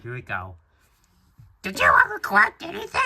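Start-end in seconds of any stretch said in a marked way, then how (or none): 1.6-3.0 put-on very high, fast girl voice; Ami. Reader taking Ami's part, high girly impression. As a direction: put on a squeaky falsetto girl. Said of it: "time to read Ami's part".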